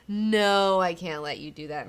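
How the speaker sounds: slow, loud, mock-solemn warning; firm warning. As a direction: stretch the words out as you forbid it. Same acted warning, repeated.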